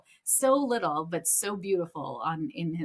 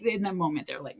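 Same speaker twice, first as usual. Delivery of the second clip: normal talking voice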